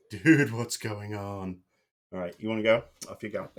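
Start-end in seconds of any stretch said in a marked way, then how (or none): 0.1-1.6 slow, lightly voiced puzzled dog; voicing the dog. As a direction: speak the dog's confused thought, drawn out. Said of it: "He's looking at himself and freaking out".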